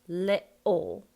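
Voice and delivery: "little" as li'ul, t swallowed; loud LI-UL with a gap; glottal stop. Shown as a demonstration. Coach repeating for the actor, glottal stop. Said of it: "It's called a glottal".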